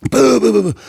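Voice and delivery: high, loud volley of boos; rapid booing. Retold or imitated. Heckler as retold to the club, loud high booing.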